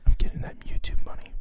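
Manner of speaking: secret whispered boast. Acted.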